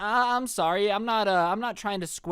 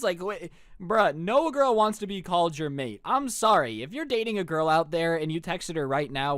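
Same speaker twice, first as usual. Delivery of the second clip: normal talking voice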